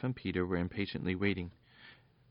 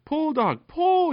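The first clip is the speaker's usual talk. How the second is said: high, loud desperate shout at the dog; much higher, louder call to pull the bucket up; urgent yell; 11 dB louder, slower than usual. Acted. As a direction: yell it up the well, pleading for speed. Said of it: "yelled Popoff".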